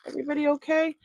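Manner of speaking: high, light check-in question; high-voiced mock concern; playful concern. Checking on dropped items.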